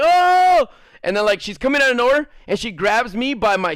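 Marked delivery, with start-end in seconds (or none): 0.0-0.7 high loud crowd "Oh!"; crowd oohing. Retold or imitated. Kids watching the fight, loud high "Oh!".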